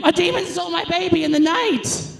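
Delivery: high panicked cry about a demon; frantic demon complaint. Retold or imitated. Mother at the hospital, panicked and loud. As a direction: shriek it like a hysterical mother. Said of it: "with the complaint of".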